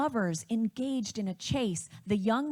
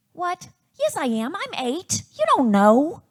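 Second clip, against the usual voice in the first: indignant big-sister retort, higher and louder; Andrea; 6 semitones higher, 9 dB louder than usual. Retold or imitated. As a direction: snap back like a child whose age is doubted. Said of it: "challenging her age".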